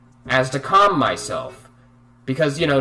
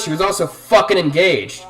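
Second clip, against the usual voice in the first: normal talking voice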